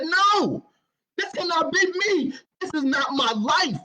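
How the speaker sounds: high desperate yell at God; desperate scream. Retold or imitated. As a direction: cry out, high and loud. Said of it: "I started screaming at the top of my lungs".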